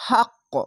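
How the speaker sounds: হাক্ক ending with a pushed, bouncing ক; qalqala stop. Shown as a demonstration. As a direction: strike the final ক of হাক্ক. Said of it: "ধাক্কা মেরে থেমে যাবেন".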